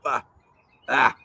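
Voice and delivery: choking cough after swallowing a bug; just swallowed a bug. Real, not acted. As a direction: splutter as if a bug went down. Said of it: "Sorry, just swallowed a bug".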